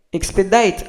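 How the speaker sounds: Expedite said the English way, ending in -dite; "Expedite" with an English long i; English pronunciation. Shown as a demonstration. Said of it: "Le mot expedite".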